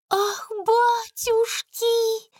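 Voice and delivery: breathless, drawn-out gasp of amazement; panting shaky eyewitness. Acted. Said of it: "задыхаясь, проговорила она".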